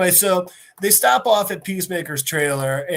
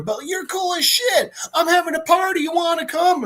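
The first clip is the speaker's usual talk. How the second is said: high, gushing invitation; high-pitched, eager enthusiasm; excited; 11 semitones higher than usual. Acted. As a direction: invite a new buddy, giddy and pitched up. Peacemaker excitedly befriends gorilla.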